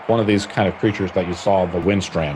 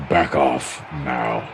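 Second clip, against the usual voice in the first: low, slow orc growl, softer; menacing orc; 7 semitones lower, 3 dB quieter, much slower than usual. Acted. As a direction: drag each word out like a threat. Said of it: "and the orc says".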